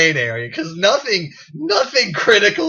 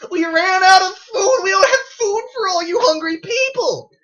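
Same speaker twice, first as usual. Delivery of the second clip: rising into a frantic high shout; exasperated yelling; 11 semitones higher, 6 dB louder than usual. Retold or imitated. Fast food worker shouting at the hungry crowd, frantic yell. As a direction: yell it at the crowd like you've run out. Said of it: "And I'm like".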